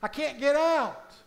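loud, high shouts from down in a hole; man stuck in a hole screams for help; screaming for help. Retold or imitated. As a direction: yell up desperately for help. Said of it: "continues to scream".

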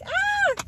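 short, high mock scream; quick high-pitched squeal, played up; imitated scream. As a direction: re-enact a startled shriek at bugs. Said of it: "you're screaming about that".

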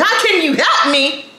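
high-pitched indignant echo; angry mimicking retort. Angry resident answering office staff, indignant mimicry. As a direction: throw the greeting back at them. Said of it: "I am angry".

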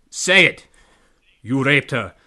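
loud demanding shout in mock Spanish accent; angry, vague Spanish accent. A narrator's reading. Pirate repeats his demand, loud Spanish-accented anger. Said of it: "the pirate repeated".

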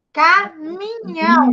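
CAMINHÃO slowly stretched in three beats; syllable by syllable. Shown as a demonstration. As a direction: sound out "Caminhão" slowly as ca-mi-nhão. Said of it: "eu vou falar nas sílabas". ("Caminhão" devagar, sílaba por sílaba)

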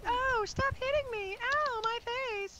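high-pitched imitated complaint; mock whining victim. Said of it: "It's always".

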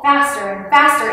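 ordinary delivery

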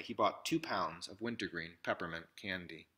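usual speaking voice

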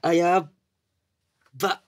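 can't contain the joy; overjoyed. Acted. Junior laughing with joy after senpai's love word.

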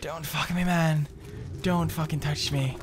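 gasped little cries, then high-pitched begging; high, frightened whimpering plea; scared pleading. Real, not acted. Being attacked in the game.

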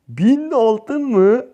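slow, drawn-out high gasp of shock; can't believe the reward; stunned disbelief. Real, not acted. Gamer to viewers, stunned by the prize: drawn-out disbelief.

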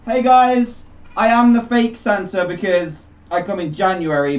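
ordinary talk, nothing marked

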